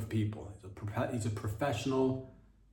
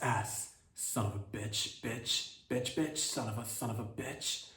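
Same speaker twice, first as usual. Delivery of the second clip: mock cursing practice; 5 dB quieter, much slower than usual. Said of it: "That's my impression of Gavin Newsom".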